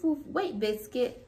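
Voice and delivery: ordinary delivery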